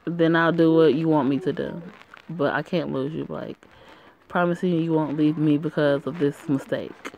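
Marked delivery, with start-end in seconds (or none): none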